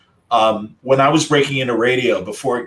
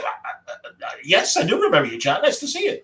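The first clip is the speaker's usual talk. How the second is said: imitating a stammer; 9 semitones higher than usual. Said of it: "He had that stammer".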